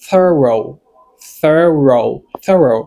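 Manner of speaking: "thorough" as THUR-oh, two clear falling syllables; pronunciation demo. Shown as a demonstration. Teacher pronouncing the word for learners, "thorough" clearly articulated. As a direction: model "thorough" carefully, pausing between repeats. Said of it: "lè m ap pwononse mò sa".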